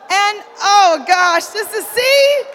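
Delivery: loud, high, slowed flustered cry; real flustered exclamation; embarrassed outburst. Real, not acted. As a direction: blurt it out, mortified and laughing. Embarrassed outburst at text story.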